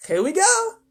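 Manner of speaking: very high, loud cry; excited cry. Acted. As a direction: call out thrilled. Excited creature at lurch.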